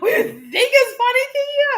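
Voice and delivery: very high mock indignation; annoyed. Wife scolds laughing husband, retold annoyance. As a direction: re-enact being exasperated at being laughed at. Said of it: "you know how annoying that is".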